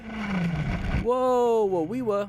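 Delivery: loud, high call to a passing rider; excited greeting. Real, not acted. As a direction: call out happily to a friend.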